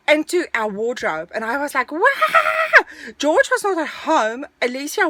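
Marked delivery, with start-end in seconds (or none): 1.9-2.8 high, loud startled shriek; startled scream. Retold or imitated. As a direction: re-enact shrieking at a rat. Retold fright at a passing rat.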